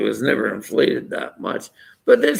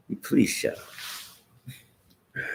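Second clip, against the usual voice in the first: mock whisper; 2 semitones higher, 8 dB quieter, much slower than usual. Retold quarrel with a critic.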